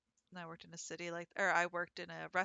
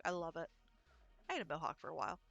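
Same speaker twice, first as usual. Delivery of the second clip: normal talking voice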